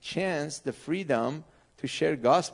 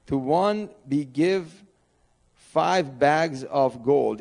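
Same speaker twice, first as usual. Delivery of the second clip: slow, louder, measured Bible reading; scripture reading; 4 dB louder than usual. Acted. Pastor reading Matthew aloud to the congregation, slow and measured. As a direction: read the verses out deliberately, word by word.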